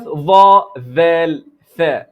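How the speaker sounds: dha, dhal, tha said firmly and spaced, tongue tip on the teeth; letters demonstrated. Shown as a demonstration. Teacher models the three letters for students, firm and spaced. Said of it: "then now let's go".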